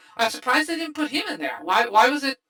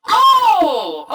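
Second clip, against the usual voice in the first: loud, high sudden oh; surprised; 10 semitones higher, 9 dB louder than usual. Real, not acted. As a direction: burst out in surprise. Suddenly learns of cameo.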